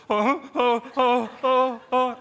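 loud, high frightened "oh, oh"; panicked yelps. Retold or imitated. Preacher acting out his response to the animal, panicked yelps. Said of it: "my response was".

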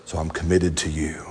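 low, hushed mock-solemn declaration; creepy. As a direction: lean in low and soft, overly earnest. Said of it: "this gets creepy".

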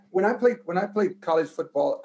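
ordinary delivery

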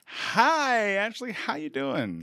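loud, high, drawn-out friendly hello; mock cheerful greeting. Host voicing an impossibly nice caller, mock cheerful. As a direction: greet with exaggerated delight.

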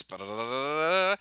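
loud rolled-tongue drum imitation; vocal drum roll. Host teases second place winner, vocal drum roll. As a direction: drum it out with the mouth, rising. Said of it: "Drum roll".

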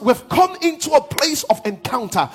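high, loud, half-sung preaching build; preaching at full force. Acted. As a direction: lift the church into a shouted, chanted climax. Preaching climax, sung and shouted.

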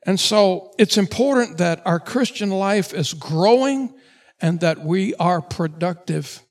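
loud, slow, drawn-out preaching; full-force preaching. Acted. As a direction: hammer each phrase home from the pulpit. Opening exhortation, loud and slow.

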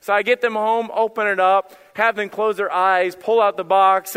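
ordinary talk, nothing marked

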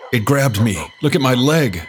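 loud, high panicked outburst; alarmed scream. A narrator's reading. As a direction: cry out as the attacked cousin. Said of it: "Joe screamed".

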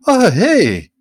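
sudden, very high, loud yelp; startled cry. A narrator's reading. Guido Baldo seeing the boy convulse, a shocked yelp. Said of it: "He looked down and guffawed".

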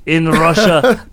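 joking about a Russian hat in a fake Russian accent; Russian accent joke. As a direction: deliver the old Russian joke in a thick Russian accent. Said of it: "This is my Russian, Russian accent".

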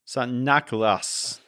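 slow, drawn-out Santa Claus in a put-on Dutch accent. Shown as a demonstration. Co-host having a go at the original name in a Dutch accent. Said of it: "I think that's how you say it".

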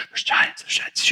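whispered There's giants; murmuring. Retold or imitated. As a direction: hush it like frightened gossip. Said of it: "people are murmuring".

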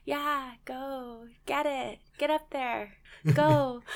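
mock the kids' feeble encouragement; weak mat talk. Cheer coach mocking her kids' timid mat talk, half-hearted. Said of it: "that is the weakest mat talk I have ever heard".

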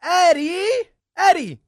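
mock-bossy shout of a name; mock yell. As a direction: call the name out sharply, imitating a bossy coworker. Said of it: "and she yells, she's like".

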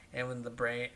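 ordinary talk, nothing marked

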